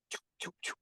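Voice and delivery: soft unvoiced choo sounds, like darts flying past; imitated dart whoosh. Officer mimics darts shot at him: soft unvoiced whooshes. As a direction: breathe out quick dart noises.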